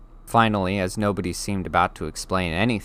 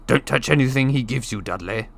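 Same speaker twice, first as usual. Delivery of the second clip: louder, tense warning snap; sharply; 5 semitones higher, 4 dB louder than usual. Acted. Uncle Vernon warns Dudley against the giant's food, sharp. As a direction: warn the boy off, suspicious. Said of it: "said sharply".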